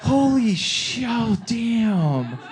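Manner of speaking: high, tearful awe, words drawn out; hammed-up overwhelmed wonder; mock choked up. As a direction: let each exclamation wail slightly. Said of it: "very emotional 'cause I had".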